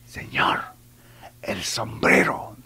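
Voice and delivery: hushed, unvoiced stage whisper; acted whisper. Lady at a wake to a drunk in a hat, whispering. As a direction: whisper discreetly to get his attention. Woman discreetly signals at a wake.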